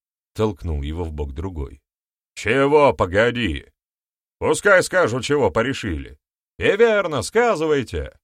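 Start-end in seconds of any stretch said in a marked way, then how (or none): 2.4-8.2 high, loud crowd voices; clamouring demands. A narrator's reading. Elders squabble and demand to hear more, clamouring loudly. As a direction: demand an answer over the others.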